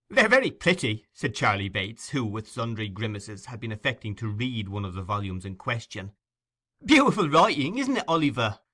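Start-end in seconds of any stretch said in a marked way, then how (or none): 0.1-1.1 high, loud jeering youngster; mocking boy. Charlie teasing Oliver over the books, mocking. 6.8-8.6 high, loud jeering youngster; mocking boy. Charlie teasing Oliver over the books, mocking.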